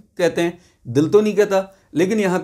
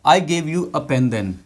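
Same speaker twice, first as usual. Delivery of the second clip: slow, clear classroom reading; slow example read; much slower than usual. Shown as a demonstration. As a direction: read it out slowly for learners. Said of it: "अब". (धीमे साफ़ उच्चारण)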